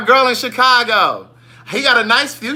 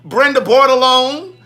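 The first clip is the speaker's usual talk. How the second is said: high, loud, relished name call; relishing the name; 4 semitones higher, 7 dB louder than usual. Real, not acted. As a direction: savour the name, pitched up and loud. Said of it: "I love saying that last name".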